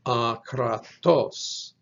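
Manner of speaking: akratos drawn out, weight on final TOS; slow a-kra-TOS, punch the end of akratos; stress on last syllable. Shown as a demonstration. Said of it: "with the emphasis on the last syllable".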